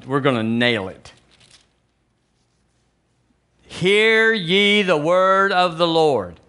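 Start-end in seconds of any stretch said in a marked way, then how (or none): 3.6-6.4 slow, ringing preacher's declaration; solemn proclamation. Acted. Preacher declares the word to the church, drawn out and emphatic. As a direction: stretch the words out like a herald. Proclaims scripture, then expounds it.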